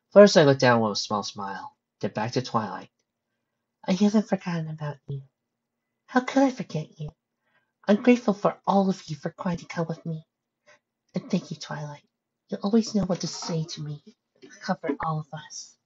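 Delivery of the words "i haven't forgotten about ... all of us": high, gentle character voice; soft-spoken, grateful pony. Acted. Pony thanking Twilight for comfort, high gentle voice. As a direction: thank them softly in a higher voice.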